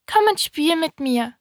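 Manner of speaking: high-pitched little prince voice; little prince inviting. A narrator's reading. Little prince asks the fox to play, high-voiced.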